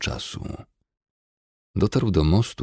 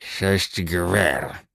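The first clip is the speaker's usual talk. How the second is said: goblin voice; 2 semitones higher than usual. A narrator's reading. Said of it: "zażądał goblin".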